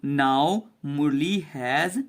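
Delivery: normal talking voice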